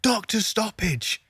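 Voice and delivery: high, slow, strained mock yell; hammed-up injured fighter demanding a stoppage; mock Conor shouting. Conor with snapped ankle yells at the ref, hammed-up high mock shout. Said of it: "still shouting in the cage, going".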